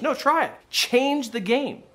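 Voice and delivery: high-pitched retold urging; imitated dad's emphatic push; insistent dad. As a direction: press the advice on a doubting kid.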